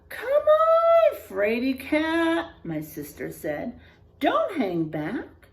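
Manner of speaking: high, loud taunting big sister; teasing sister. Acted. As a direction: tease your little brother from ahead. Teacher voices the sister.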